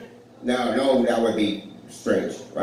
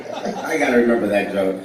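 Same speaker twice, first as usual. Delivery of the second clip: normal talking voice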